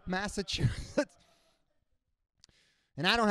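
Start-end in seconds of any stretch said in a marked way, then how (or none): 0.1-1.1 Massachusetts said slow with a rising end; Massachusetts mispronounced by a Cuban dad; dad's mispronunciation. Retold or imitated. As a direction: stretch out Massachusetts like a learner. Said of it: "That's how he says".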